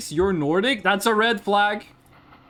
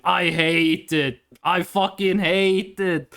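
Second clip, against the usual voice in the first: slow, drawn-out exasperation; exasperated; 3 semitones lower, slower than usual. Real, not acted. Streamer venting about Nazi talk, exasperated. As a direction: drag out each word in loathing.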